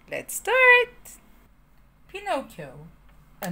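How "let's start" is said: high bright call to begin; excited start. Real, not acted. Teacher rallying the class to start, excited. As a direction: kick off the story with a burst of energy.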